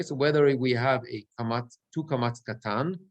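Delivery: ordinary delivery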